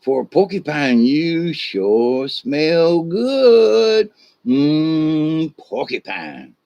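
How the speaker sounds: slow, drawn-out, relishing the smell; savoring mountain lion. Acted. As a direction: stretch the words out like a cat savoring prey. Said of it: "sniffs at him and goes".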